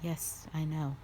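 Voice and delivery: quiet regret. Acted. Dragon softly confessing to the human that she kills only when asked.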